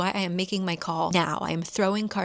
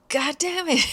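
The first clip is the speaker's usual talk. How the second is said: exasperated outburst; 8 semitones higher, 4 dB louder than usual. Retold or imitated. Cop exasperated at the fake name.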